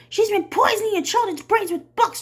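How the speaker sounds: high, loud rabble-rousing voice; declaring. Acted. Trout rousing the townsfolk against the teacher, high and loud. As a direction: whip the mob up. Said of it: "Trout declared".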